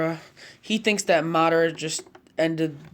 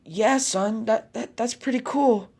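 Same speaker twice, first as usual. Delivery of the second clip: higher-pitched, hesitant praise; impressed mother; 6 semitones higher, 4 dB louder than usual. Acted. Mother praising her son's jutsu, impressed.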